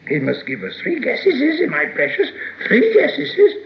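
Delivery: high-pitched hissy creature voice; bargaining Gollum. A narrator's reading. Gollum demanding three guesses from Bilbo, high and hissy. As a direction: insist in a squeaky hiss.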